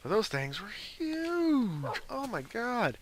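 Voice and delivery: amazed. Real, not acted. Amazed at wolfhounds.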